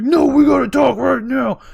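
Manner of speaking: loud, high shout back in a fight; imitated angry shout. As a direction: play the older brother yelling his demand. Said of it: "He's like".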